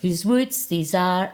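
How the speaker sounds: slow, lilting 'whose woods these are'; sing-song poetry voice. Shown as a demonstration. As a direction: lean into the recitation lilt. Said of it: "with a wee bit of the poetry voice".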